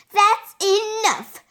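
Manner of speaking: loud, high teacher's reprimand; shouted. A narrator's reading. Teacher shouting from bus front, sharp command. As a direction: shout to stop them cold. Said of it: "Missus Dirkman shouted".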